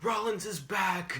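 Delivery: imitating a thrilled commentator, stretching the words; mock announcer hype. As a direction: hype up the big return in mock excitement.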